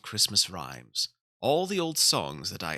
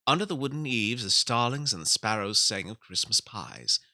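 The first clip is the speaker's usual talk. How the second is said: ordinary delivery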